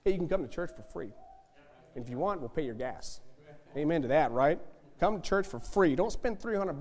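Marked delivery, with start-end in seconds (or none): none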